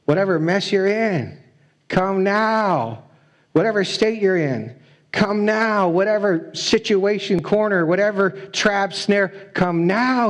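slow down and plead on the last lines; God pleading. Acted. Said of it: "He says".

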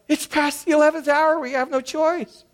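high, urgent mock panic; hammed-up desperation over the deadline. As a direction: pastor and Paul caving to the credit card, lightly mock-panicked. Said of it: "Paul and I were like".

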